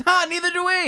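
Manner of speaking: high, laughing me-too; laughing mock reply. Joining the mockery of Democrats, high and laughing. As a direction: giggle it out as a yes-man. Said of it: "They don't like Bernie Sanders".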